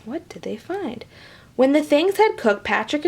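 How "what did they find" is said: usual speaking voice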